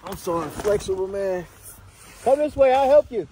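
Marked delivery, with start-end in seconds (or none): 2.2-3.3 calling out. Real, not acted. Calling out to friend on slope.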